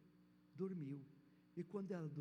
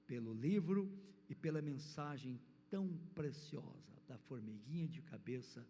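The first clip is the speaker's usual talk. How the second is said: normal talking voice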